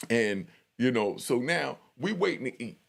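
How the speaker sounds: normal talking voice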